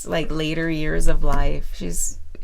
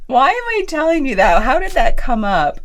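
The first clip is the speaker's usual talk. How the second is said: higher, louder bewildered question; higher and louder, laughing confusion; baffled; 7 semitones higher, 5 dB louder than usual. Retold or imitated. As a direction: toss it out amused and puzzled. Retold own baffled reaction.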